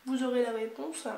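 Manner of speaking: ordinary delivery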